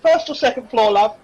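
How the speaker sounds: put-on high-pitched serving girl; offhand question to guests in a barmaid voice; brusque barmaid. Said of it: "she goes".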